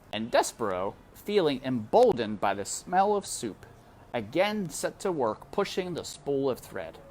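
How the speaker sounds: usual speaking voice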